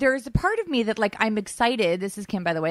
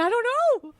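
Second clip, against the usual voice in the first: much higher, exasperated little cry; helpless squeak; 13 semitones higher, much faster than usual. Retold or imitated. Mother with a child, doubting the leap, helpless high squeak. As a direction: squeak it out like you're overwhelmed. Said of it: "I'm sitting here thinking".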